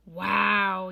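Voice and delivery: put-on loud gleeful kids' yell. A narrator's reading. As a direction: shout it out like delighted children. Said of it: "yelled the kids".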